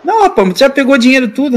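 fast, high-pitched mock scolding; exasperated objection. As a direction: remonstrate with a stubborn youngster. Official scolding the reluctant player.

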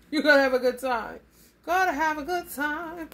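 a gleeful, emphatic promise; excited. Real, not acted. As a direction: really sell the good time. Laughing, repeated emphatically.

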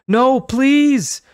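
put-on high, loud frightened child; terrified plea. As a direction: beg in terror. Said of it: "he cried".

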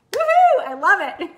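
loud, high woohoo breaking into laughter; whoop, then laugh with delight; excited whoop. Real, not acted. Coach celebrates how mindset shifts work, excited whoop.

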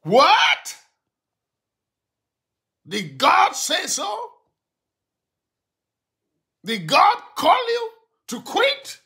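high, loud, stunned rebuke; incredulous shout. Real, not acted. Pastor shouting down a congregant who wants to quit, incredulous. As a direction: shout the questions like you can't believe it.

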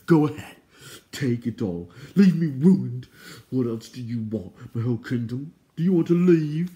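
loud, wounded tantrum in bursts; indignant outburst. A narrator's reading. Insulted king giving away his riches, loud indignant outburst. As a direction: fling each offer out, loudest on the key words.

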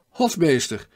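first hail to the steward, loud; calling out with a foreign accent. A narrator's reading. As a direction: call Hofmeester! across the deck with a foreign lilt. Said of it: "riep hij met een tongval die duidelijk de vreemdeling verried".

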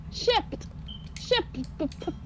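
"Shipped" with a popped final P; emphasis on the P. Shown as a demonstration. Player clarifying shipped with turtles, hard P in "Ship". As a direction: pop the P in "Ship", then repeat "p, p, p". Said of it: "emphasis on the P".

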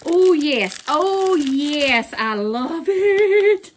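3 exclaim with growing delight; 4 delighted by the farm gift, building to a high coo; 1 thrilled. Real, not acted.

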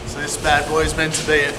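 ordinary delivery

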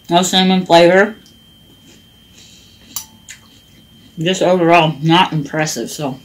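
chewing between words; eating while speaking; mouth full of cereal. Real, not acted. Seen 3: Spoon goes to mouth repeatedly during the words; eating cereal.

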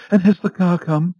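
high, loud, fretful lady's voice; a nervous wife calling to her butler; anxious Mrs Foster. A narrator's reading. Anxious wife asks the butler the time, high and fretful.

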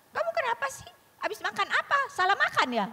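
high-pitched mock nagging questions; accusing. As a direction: put on a needling, high accusing voice. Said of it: "Kecuali dia bilang".

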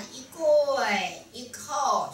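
very slow 一跪一叩, syllables held; drawn-out ritual call. Shown as a demonstration. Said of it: "都拉长音". (拖长音唱喊“一跪一叩”)

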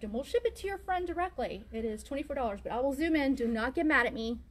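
normal talking voice

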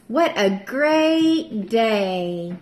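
ordinary delivery

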